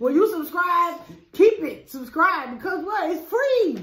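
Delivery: hyped-up pitch. Real, not acted. Hyping viewers to subscribe.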